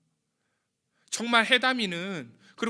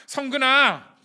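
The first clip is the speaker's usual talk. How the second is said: a loud, tender call of the name; God calling his name; 8 semitones higher, 11 dB louder, much slower than usual. Retold or imitated. God calls the young pastor by name, lightly voiced loving call. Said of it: "그 이야기를 하시면서".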